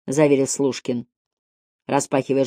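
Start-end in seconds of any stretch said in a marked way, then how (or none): none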